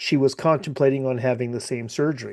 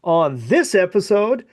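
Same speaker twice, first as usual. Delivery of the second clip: higher announcer voice for the intro; podcast host intro; 7 semitones higher than usual. Acted. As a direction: sell it like a radio host. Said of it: "you nailed that".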